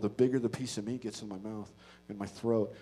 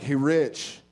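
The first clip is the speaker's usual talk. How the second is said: loud, raised hail; confrontational call-out; 3 semitones higher, 7 dB louder than usual. Retold or imitated. Man confronting his arch enemy in the kitchen, bold call-out. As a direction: call him out across the kitchen.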